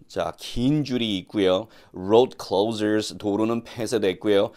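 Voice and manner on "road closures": slow, careful model sentence; slow model reading. Shown as a demonstration. Teacher modelling English slowly for students, slow and careful. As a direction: read it out slowly for learners to copy.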